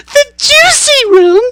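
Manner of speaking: very high, loud, slow shriek. A narrator's reading. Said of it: "cried Missus Beauregard".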